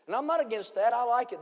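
loud, high preaching cry; preaching at full force. Acted. Preacher shouting to the congregation, full-force preaching.

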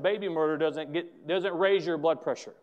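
ordinary talk

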